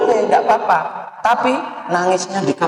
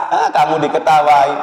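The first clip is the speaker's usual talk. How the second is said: usual speaking voice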